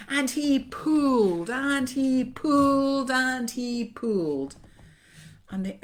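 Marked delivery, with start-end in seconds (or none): 0.1-4.5 loud, high, stretched heave; laboured chant of tugging; straining effort. A narrator's reading. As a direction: heave rhythmically on every "pulled". Narrator acts out repeated pulling.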